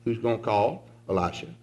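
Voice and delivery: ordinary delivery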